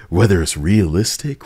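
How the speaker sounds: normal talking voice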